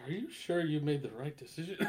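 hushed mock concern with a laugh underneath; sister's hushed doubt. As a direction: murmur it like a worried sister, amused. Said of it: "Her, her sister's talking to her".

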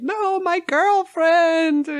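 high, slow, loud mock wail; anguished cry. Reese diving after his drowning girlfriend, a slow high mock wail. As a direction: wail it out like a soap opera. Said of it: "she's like".

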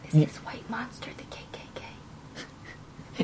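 soft unvoiced whisper, slow. Real, not acted. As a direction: breathe the question conspiratorially. Player whispers joke question.

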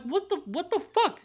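high-pitched mock shock; mock disbelief. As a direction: squeak it out in disbelief. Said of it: "I'm like".

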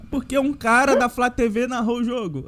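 normal talking voice

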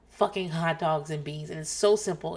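normal talking voice

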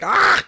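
sudden high-pitched loud shout; mock German yell. Shown as a demonstration. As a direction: burst of German-caricature yelling. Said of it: "trying to do a German accent".

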